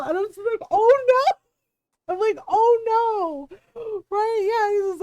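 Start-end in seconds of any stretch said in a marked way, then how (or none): 0.7-1.4 high, loud shriek of mock horror; a loud, high cry of delighted horror; horrified oh no. Her own horrified reaction quoted. 2.5-3.6 mock horror. Repeats her horrified reaction.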